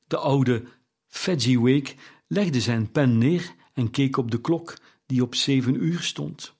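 ordinary delivery

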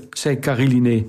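ordinary delivery

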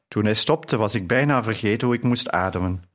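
ordinary delivery, nothing marked

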